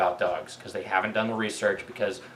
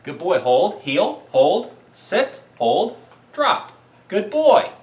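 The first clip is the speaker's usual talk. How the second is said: loud, higher commands to a dog; firm dog commands; 7 semitones higher, 5 dB louder, slower than usual. Real, not acted. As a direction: call each command out crisply to the dog.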